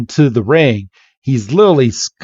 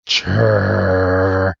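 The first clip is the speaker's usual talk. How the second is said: low, held trilling growl; long steady rumble; imitated creature noise; 5 semitones lower than usual. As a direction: mimic an annoyed little creature's churr. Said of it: "It's like".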